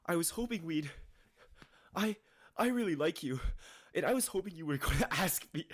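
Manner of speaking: high, gushing anime voice; anime character voice. Retold or imitated. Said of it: "Why does he talk like an anime character".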